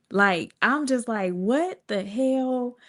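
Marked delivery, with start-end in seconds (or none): 1.3-2.8 slow, drawn-out, higher-pitched astonishment; retold disbelief. Walking back to the lunchroom, slow stunned disbelief. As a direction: stretch it out as if stunned. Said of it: "in disbelief".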